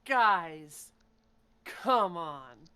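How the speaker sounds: drawn-out, weary disbelief; exasperated. Acted. Woman facing soldiers with machine guns, exasperated. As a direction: chide them, stretching each phrase.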